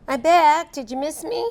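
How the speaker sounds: high, bright dog voice; playful character voice. Acted. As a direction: pop back in cheekily.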